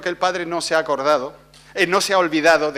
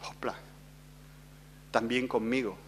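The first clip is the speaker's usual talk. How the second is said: quiet inner thought; 8 semitones lower, 16 dB quieter than usual. Retold or imitated. Inner realization retold quietly.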